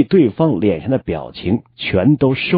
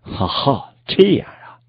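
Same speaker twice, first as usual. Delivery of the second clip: losing interest; 3 semitones higher, slower than usual. A narrator's reading. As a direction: brush it off with a light "oh, I see". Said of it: "就彻底的对他失去了兴趣".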